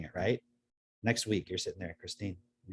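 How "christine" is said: very soft, low call; hushed, lower inner voice; disembodied voice. Acted. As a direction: murmur the name like a voice in someone's head. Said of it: "like, this voice".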